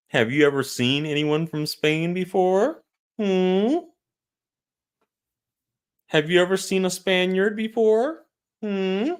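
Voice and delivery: high, rising disbelief; incredulous questioning. Real, not acted. Asking who has seen a Spaniard, incredulous. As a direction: question them with pitch climbing to the end. Challenging the comment that they look Spanish.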